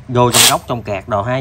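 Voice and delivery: ordinary delivery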